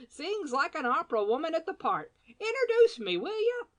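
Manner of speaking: put-on high, loud teasing old gent; playful rebuke. A narrator's reading. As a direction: wag a finger, all roguish fun. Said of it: "exclaimed, shaking his head".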